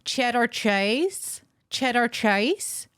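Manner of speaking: put-on drawn-out American accent, rising at the end. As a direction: put on a slow, exaggerated American voice. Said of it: "in an American accent".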